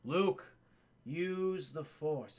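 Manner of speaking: slow, hushed voice from beyond; ghostly mentor voice. Acted. Ben's voice reaching Luke in the trench, slow and ghostly. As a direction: intone it slowly, like a spirit guide. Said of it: "he hears that voice".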